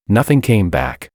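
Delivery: ordinary delivery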